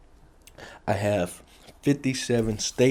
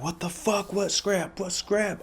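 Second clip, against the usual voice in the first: higher, frantic confused friend; panicked girls; 10 semitones higher, 4 dB quieter than usual. Retold or imitated. Girls asking who stabbed him, high panicked disbelief.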